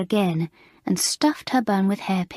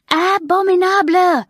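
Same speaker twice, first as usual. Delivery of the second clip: Abominable shrieked much higher and louder; raging louder still; 11 semitones higher, 8 dB louder than usual. A narrator's reading. Mamselle raging at the class, higher furious shriek. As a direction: top the last cry with a furious shriek of Abominable. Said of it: "she raged".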